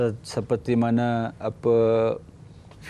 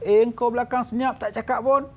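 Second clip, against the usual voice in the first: husband calls back; 9 semitones higher than usual. Retold or imitated.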